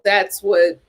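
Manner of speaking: ordinary talk, nothing marked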